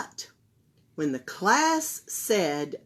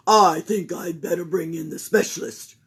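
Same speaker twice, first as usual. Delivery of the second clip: loud pompous doctor; Doctor Bumble deciding; Doctor Bumble on the phone; 4 semitones lower, 7 dB louder than usual. Acted.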